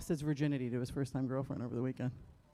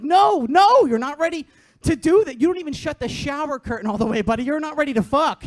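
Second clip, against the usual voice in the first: much higher, much louder frantic shouting; inner panic yell; 7 semitones higher, 12 dB louder than usual. Pretend. Mom's inner voice screaming at her son, frantic shout. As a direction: scream the panic you hide. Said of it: "inside, I'm like".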